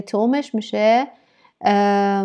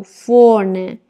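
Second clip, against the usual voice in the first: normal talking voice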